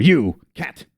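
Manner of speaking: sudden pointed call; sharp address. Acted. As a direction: snap the attention onto a new target. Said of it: "looks over sharply".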